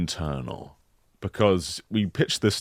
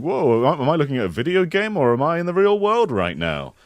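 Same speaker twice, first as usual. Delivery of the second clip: mock amazement; 8 semitones higher, 4 dB louder than usual. Imagined gamer's amazed thought.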